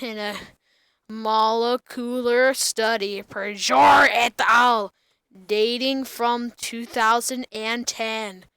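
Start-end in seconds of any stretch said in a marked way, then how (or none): 3.2-4.9 shouting. Acted. Names shouted mid-reading.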